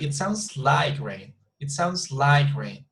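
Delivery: "like" drawn out as the peak, slow; stress on like. Shown as a demonstration. Teacher models opinion for a student, slowly stressing "like". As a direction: climb to "like", then step down on "rain". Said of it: "emphasize on like, please".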